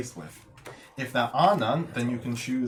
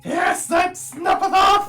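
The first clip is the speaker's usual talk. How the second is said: frantic high shout, much louder; panicked NPC; 10 dB louder, slower than usual. Acted.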